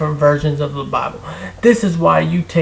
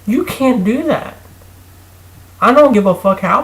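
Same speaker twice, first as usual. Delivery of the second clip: indignant outburst; 7 semitones higher, 4 dB louder than usual. Real, not acted. Heated rant at Christians.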